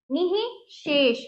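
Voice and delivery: निःशेष broken apart slowly as ni-hi-shesh with a clear ह; slow syllable-by-syllable. Shown as a demonstration. Teacher breaks निःशेष into parts for pupils, slowly. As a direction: spell out निःशेष slowly, each piece separate. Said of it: "देखिए इसमें ये देखिए निःशेष".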